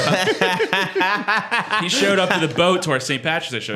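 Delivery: laughing while telling it; words broken up by laughter. Real, not acted.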